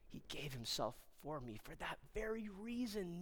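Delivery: very soft, halting reflection; hushed awe. Acted. Peter marvels at Jesus dying for him, hushed and reverent. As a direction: let the voice fall away, pausing between phrases.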